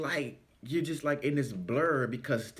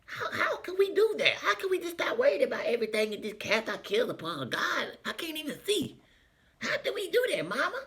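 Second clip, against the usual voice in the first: put-on high, baffled little kid asking; puzzled child voice; 12 semitones higher than usual. As a child, asking mama, puzzled and high. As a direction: sound like a confused child reading scripture. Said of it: "I'm reading it like".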